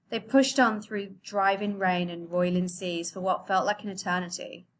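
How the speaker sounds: normal talking voice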